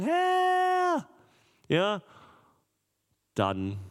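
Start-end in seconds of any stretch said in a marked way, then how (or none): 0.0-1.1 much higher, louder anguished call; desperate cry. Acted. Disciples calling out to Jesus, desperate and loud. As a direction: cry out for help from the sinking boat.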